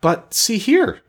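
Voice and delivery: slow, very high-pitched dismay; high, aghast pumpkinhead; gasping objection. A narrator's reading. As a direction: draw it out in startled protest. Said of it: "said Jack Pumpkinhead with a gasp".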